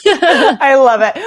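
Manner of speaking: slow, laughing delight; a real chuckle while saying it. Real, not acted. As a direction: laugh through the words. Shared laugh about the name.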